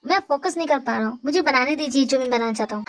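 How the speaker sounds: ordinary talk, nothing marked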